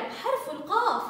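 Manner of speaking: ordinary talk, nothing marked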